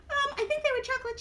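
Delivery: high puppet voice; Mimi. Acted. Mimi says she likes the cow, high puppet voice. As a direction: pipe up sweetly as a tiny character.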